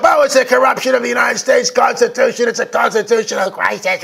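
hammed-up pearl-clutching voice; mock her outrage; mock Pelosi. Host mocking Pelosi's speech, hammed-up outrage. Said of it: "Pelosi gets up".

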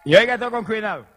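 loud, high pulpit call; preacher's call for attention. Real, not acted. Preacher telling the congregation to listen carefully, loud exhortation. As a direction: hit it hard to grab the congregation.

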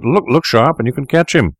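high-pitched urgent landlord; eager high-voiced alert; excited cry. A narrator's reading. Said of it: "cried the landlord".

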